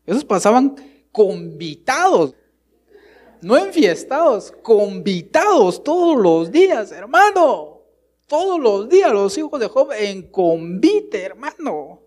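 más agudo y fuerte, alargando días; predicación enérgica. Acted. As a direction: predica con fervor sobre los hijos de Job. Predica con fuerza. (el predicador proclama a la iglesia, más agudo y fuerte)

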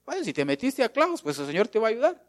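mock aside.

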